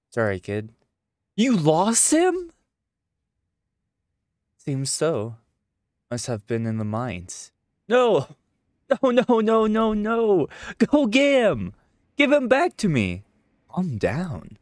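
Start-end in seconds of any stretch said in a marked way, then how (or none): 1.3-2.5 put-on dismayed child. Kid learns toy is lost. 7.9-13.2 put-on high, loud, desperate child yelling no; child's frantic screaming. Child screams at the adult to fetch the toy, frantic. Kid panics over lost toy.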